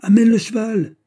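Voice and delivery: very high, loud holler; yelled order. A narrator's reading. Planchet relaying the order through the window, yelled order. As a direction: yell it down to the street. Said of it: "hurla Planchet".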